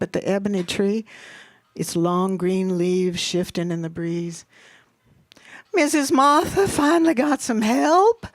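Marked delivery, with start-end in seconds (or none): 5.7-8.3 high, loud tipsy mama; gin voice. A narrator's reading. Tipsy Mama remarks on Missus Martha's new help, gin voice. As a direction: call it out with gin in you. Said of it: "said in her gin voice".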